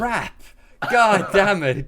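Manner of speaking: loud, exasperated curse at himself; frustrated outburst. Real, not acted. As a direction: shout it in sudden frustration. Realizes villain could have escaped.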